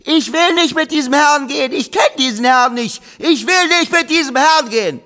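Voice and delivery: put-on high loud child's yell, stress on will; shrill outraged little girl shouting; loud complaining. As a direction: make a scene so everyone hears. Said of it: "legt Sasi von neuem los".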